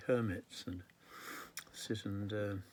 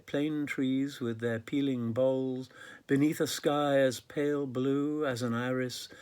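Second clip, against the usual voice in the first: measured, projected poet's recital; poetry read out to an audience; poem reading; 3 semitones higher, 7 dB louder than usual. Acted. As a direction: recite the verse with deliberate phrasing. Reads third poem aloud.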